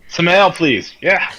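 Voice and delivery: high, loud, overeager order; forced casual cover. Acted. Ordering ale to change the subject, forced casual cover. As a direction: pipe up loudly to cover.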